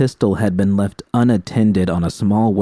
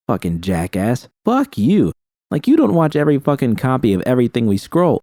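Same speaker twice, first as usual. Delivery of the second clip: heated bickering; 6 semitones higher than usual. Acted. Ring members bickering over porn.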